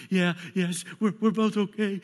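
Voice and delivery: high, loud, stammering reassurance; nervous girl telling dad they're fine; shaken daughter. Retold or imitated. Daughter on the phone, still shaken but assuring dad.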